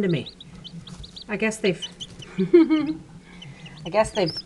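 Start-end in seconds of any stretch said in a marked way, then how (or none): 1.9-3.2 loud, higher-pitched chuckle. Real, not acted. Keeper amused that chicks come to her, chuckling. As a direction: break into a short laugh.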